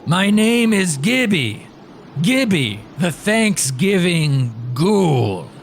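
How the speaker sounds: slow, loud ghoul voice; ghoulish voice. Acted. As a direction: drag out the name like a ghoul. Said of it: "ghoulish voice".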